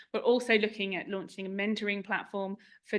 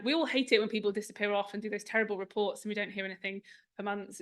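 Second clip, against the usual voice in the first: normal talking voice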